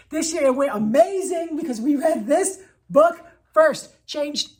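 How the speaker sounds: teacher thrilled by the change; excited. Real, not acted. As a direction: sell the amazing result. Excited about the new result.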